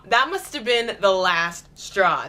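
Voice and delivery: usual speaking voice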